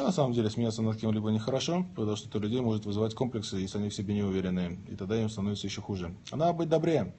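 ordinary talk

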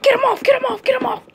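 high loud frantic yell; panicked shout. Acted. Fighter begging the crowd to pull the opponent off, panicked shout. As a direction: scream for help as you're pinned.

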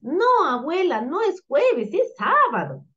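put-on high-pitched cheeky girl puppet; playful girl. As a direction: correct grandma as a little girl. Girl puppet playfully mishears her grandmother. (niña juguetona con voz aguda)

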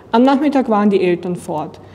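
ordinary delivery

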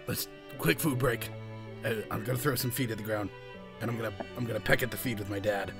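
ordinary talk

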